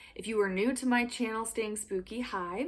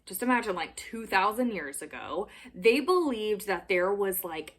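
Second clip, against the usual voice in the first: usual speaking voice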